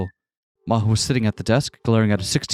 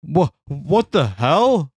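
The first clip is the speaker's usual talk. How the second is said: slow, higher stunned stammer; 6 semitones higher, much slower than usual. Acted. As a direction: gape at the room. Stunned entering changed room.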